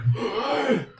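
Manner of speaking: high acted gasp; waking gasp. Game master voicing the healed player waking up, sharp gasp. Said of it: "you wake up and you're like".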